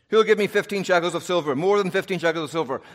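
loud auctioneer calling for bids. Retold or imitated. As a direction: call it out to the crowd. Auctioneer calling final bid.

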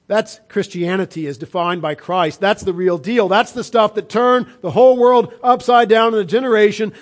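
normal talking voice

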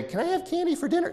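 put-on very high, fast pleading kid voice; begging child. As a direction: wheedle like a little kid. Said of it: "Then you say".

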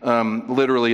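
normal talking voice

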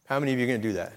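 usual speaking voice

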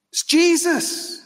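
high impassioned preacher voice, slowing on the answers; preaching at full force. Acted. Preaching climax, rhetorical questions.

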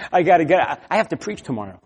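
fast, fretful grumble; irritated father woken at night; exasperated. Retold or imitated. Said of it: "It was more like".

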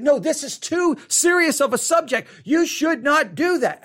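mock reproach; scolding listener. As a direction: play a disapproving listener. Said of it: "I know you're gonna be like".

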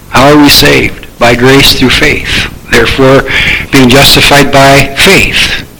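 ordinary talk, nothing marked

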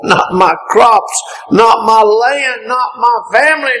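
usual speaking voice

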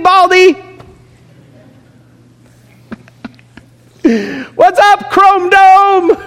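very high, loud shouting taunts; taunting children. Retold or imitated. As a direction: yell insults like rowdy kids. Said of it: "They mocked him".

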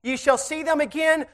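slow, emphatic scripture read; solemn preacher's reading; preaching scripture. Acted. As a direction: lay each word down slowly.

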